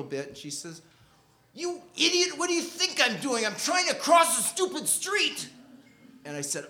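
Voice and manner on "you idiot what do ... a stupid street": high, far-off voice snapping back in outrage; retold angry snap. Girl in the crosswalk snaps at the intruding narrator, outraged. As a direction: bite his head off, voice pitched way up.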